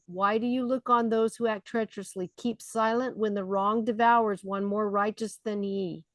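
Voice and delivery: usual speaking voice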